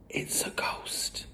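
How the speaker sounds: slow, voiceless spooky whisper; awed whisper. A narrator's reading. Matilda scares the family about a ghost, whispering. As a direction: breathe it out in dread.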